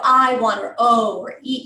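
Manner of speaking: "I", "oh" and "eat" stretched slow and loud; slow, open-mouthed vowels on "I" and "oh"; big round vowels. Shown as a demonstration. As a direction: exaggerate the mouth shapes on "I", "oh", "eat". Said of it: "these big round movements".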